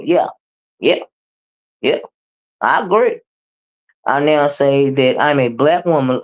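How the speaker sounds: ordinary talk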